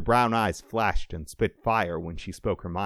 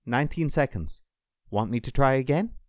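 lightly voiced woman medic, higher; a touch of urgency, checking with the doctor; anxious medic; 6 semitones higher than usual. A narrator's reading. Medic asks doctor, syringe primed.

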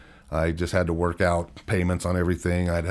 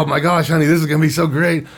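higher and louder, bubbling with excitement; excited to wife; 8 semitones higher, 7 dB louder, faster than usual. Retold or imitated. He imagines telling his wife about a raise, higher, louder and excited. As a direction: gush like you're about to get a raise.

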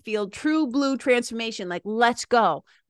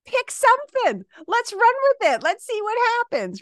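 much higher, louder rallying call; rallying cheer; 11 semitones higher, 4 dB louder than usual. Real, not acted. Coach invites listeners to act, high and energetic. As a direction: pump up the listeners. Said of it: "That's my invitation to you".

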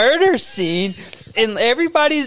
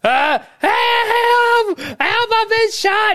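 very high, drawn-out wail; overacted scream for help; mock victim screaming; 12 semitones higher, much slower than usual. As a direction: imitate a shot woman's cries. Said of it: "then you hear [name]".

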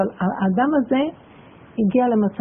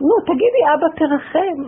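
high pleading cry, raised voice; mimicking a mother's frantic plea; retold shout; 6 semitones higher than usual. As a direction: call out begging, pitched way up. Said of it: "היא צועקת".

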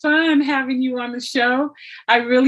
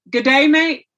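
attempted Australian accent; 2 semitones higher, 4 dB louder than usual. Shown as a demonstration. American host asking her guest, tentative Australian accent. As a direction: try out an Australian greeting, loudly. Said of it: "help me get this accent right".

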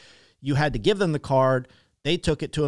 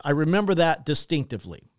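usual speaking voice

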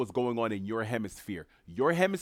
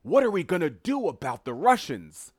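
higher, louder mock panic, hammed-up; mock pearl-clutching; 6 semitones higher, 6 dB louder than usual. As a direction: play the fretting pearl-clutcher. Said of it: "pearls are being clutched".

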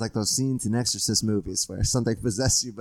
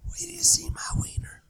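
seductive whisper; 4 dB quieter than usual. Acted. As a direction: breathe it like a secret. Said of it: "You just started whispering".

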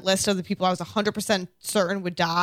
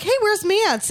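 mock high wildling voice; 13 semitones higher than usual. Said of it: "they're like".

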